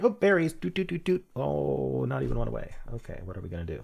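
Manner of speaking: high, sing-song start settling into resignation; lightly mock a hasty player's letdown; imitating players. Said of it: "people are like".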